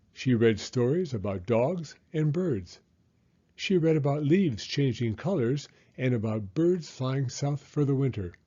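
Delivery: ordinary delivery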